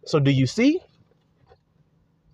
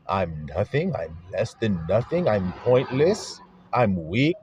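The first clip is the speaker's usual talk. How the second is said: voicing self-talk; 6 semitones lower, 3 dB quieter than usual.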